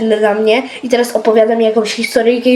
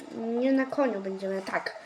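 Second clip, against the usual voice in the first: ordinary delivery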